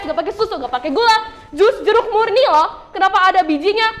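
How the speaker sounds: high-pitched, hammed-up customer complaint; imitated yelling. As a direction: scold the juice seller in a mock outburst. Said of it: "Tiba-tiba ibunya teriak".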